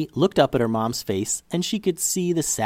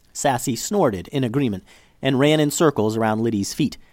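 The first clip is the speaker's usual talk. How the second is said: usual speaking voice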